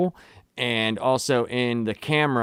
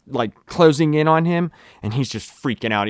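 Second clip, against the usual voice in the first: ordinary talk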